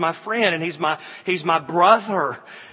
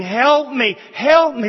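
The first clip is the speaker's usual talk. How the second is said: desperate plea; 6 semitones higher, 5 dB louder than usual. Retold or imitated. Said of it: "people are going to hell who are saying".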